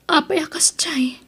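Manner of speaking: hushed, broken whisper; whispered question. Acted. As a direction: whisper it in hurt disbelief. Daughter hurt by father's rejection.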